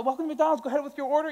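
high, bright order-taker voice; chirpy fast-food greeting; drive-thru worker voice. Retold or imitated.

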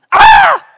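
high, loud wail of a hungry newborn; mock baby wail. Preacher imitating a hungry newborn, loud high wail. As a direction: wail like a baby screaming for milk.

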